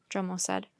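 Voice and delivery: usual speaking voice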